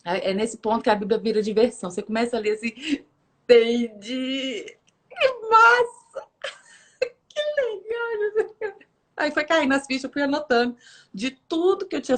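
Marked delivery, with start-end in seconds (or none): 3.4-8.8 slow, high-pitched giggly delight; reading while laughing. Acted. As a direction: act out giggling with delight as you read. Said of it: "eu lendo a Bíblia e dando risada". (lendo e dando risada, encantada)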